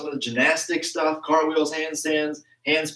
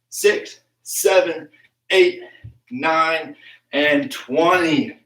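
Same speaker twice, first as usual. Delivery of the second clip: counting out reps; 3 semitones higher, 4 dB louder, much slower than usual. Real, not acted. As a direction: call each number out separately to the class. Counts squats for class.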